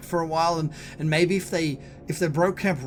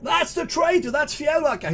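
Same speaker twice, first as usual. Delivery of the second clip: high, shouted denunciation; lieutenant raising the alarm at the top of his voice; screamed accusation; 12 semitones higher, 4 dB louder than usual. Acted. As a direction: scream it to your soldiers. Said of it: "he screams to his soldiers".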